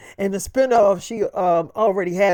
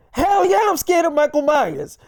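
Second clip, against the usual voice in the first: high-pitched emphatic retold reply; mock-scared high outburst; emphatic admission; 7 semitones higher than usual. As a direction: blurt it out high, imitating her. Acted reply admitting fear of villain.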